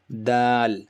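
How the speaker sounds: Dal with tongue tip at the gum. Shown as a demonstration. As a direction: tap Dal behind the upper teeth. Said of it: "to pronounce this da sound".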